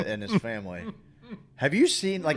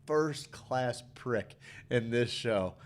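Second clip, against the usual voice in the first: slow, word-by-word emphasis; deliberate, drawn-out put-down; slow emphatic verdict; much slower than usual. Real, not acted. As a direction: draw out each word for weight.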